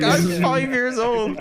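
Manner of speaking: put-on high, very slow little-kid voice through laughs; squeaky mock five-year-old; imitating a kid.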